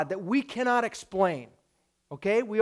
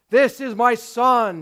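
loud, slow divine proclamation; stretched, booming declaration; booming voice from heaven; 2 semitones higher, 7 dB louder, slower than usual. Acted. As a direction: announce it loudly as God. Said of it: "you hear this loud, booming voice from heaven".

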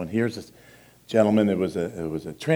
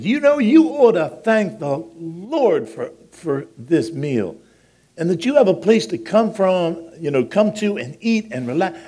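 loud, high, slow lecturing of an ungrateful man; preachy scolding; 9 semitones higher, 8 dB louder than usual. Retold or imitated. Dishwasher scolding a man in the food line, raised voice.

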